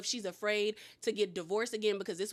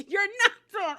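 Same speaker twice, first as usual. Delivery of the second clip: high laughing retort; 8 semitones higher, 6 dB louder than usual. Real, not acted. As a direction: laugh out the line. Laughs while talking.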